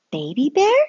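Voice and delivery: put-on recognizing an old friend; surprised mommy asking.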